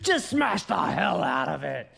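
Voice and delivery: slow, shouted wrestler hype with laughter; wrestling promo. Acted. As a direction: roar it to the crowd like a wrestler. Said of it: "my big wrestling impression".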